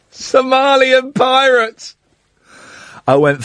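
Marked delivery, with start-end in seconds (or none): 0.1-2.0 slow, laughing disbelief; incredulous repeat. Real, not acted. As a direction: stretch it out in amused disbelief. Incredulous repeat of caller's tweet.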